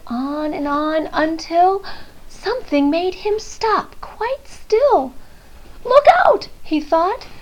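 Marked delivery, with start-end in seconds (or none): 5.8-6.5 high sudden alarm; a scared little boy's gasp of warning; startled warning. Acted. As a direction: blurt it out startled. Eddie startled by something.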